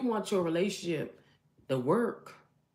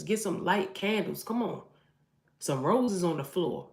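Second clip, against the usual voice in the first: ordinary delivery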